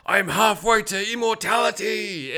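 high, drawn-out madman voice; hammed-up maniac declaration; mock crazed proclamation. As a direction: imitate a deranged cult dad proclaiming. Crazed dad proclaims his power.

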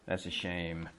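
soft, disappointed sigh of a phrase; mild regret. Shown as a demonstration. Teacher modelling regret, said softly. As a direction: say it softly, a little let down.

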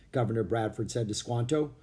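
plain reading voice

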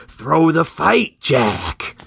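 slow, drawn-out order from the crowd; stretched-out mobster warning, dropping on the name; crime boss heckling. Acted. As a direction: lean on a fighter with a put-on voice. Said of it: "Fallon in the crowd basically being like".

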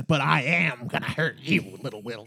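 mock creature voice, low-key menace; hammed-up creepy threat; creature's menacing shriek. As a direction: play a little monster taunting its prey. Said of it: "it shrieks at him in a way that says".

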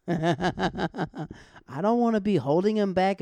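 slow words broken by laughter; giggling self-mockery; laughing through it. Real, not acted. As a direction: laugh the line out loud and slow. Laughs while saying it.

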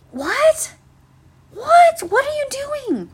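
very high-pitched, disbelieving questions; incredulous squeal. Acted. Worker asking customers what they are doing, high-pitched incredulity.

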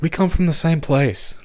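high, incredulous sitcom retort; slightly squeaky mock protest; Jerry. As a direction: pitch it up in comic disbelief. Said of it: "And Jerry's like".

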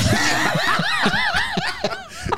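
high shrieking laugh. Real, not acted. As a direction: burst out laughing. Friend bursts out laughing.

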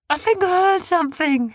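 high, nervous creature; nervous beast. A narrator's reading. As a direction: voice it wary.

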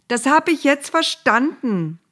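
high, loud cutting in; exasperated Moses, voice jumping up; impatient interruption. A narrator's reading. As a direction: burst in over the other speaker. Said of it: "fiel ihm Mose ins Wort".

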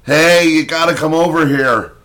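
loud high call across the yard; retold yell for a friend to come. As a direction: shout to get your friend over. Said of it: "Like that".

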